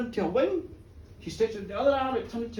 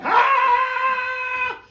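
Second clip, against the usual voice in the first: a high, loud drawn-out yell; a charging cry as he jumps; leaping yell; 13 semitones higher, 8 dB louder than usual. Acted. Connor jumping over the rock at the women, a long high yell.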